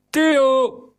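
captain roaring for Theo. A narrator's reading. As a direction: bellow for Theo across the deck. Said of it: "brulde de kapitein".

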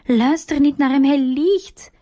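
a hint of desperate yelling; mild shout. A narrator's reading. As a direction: Sylvia crying out while pulled away, mildly voiced. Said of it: "schreeuwt Sylvia".

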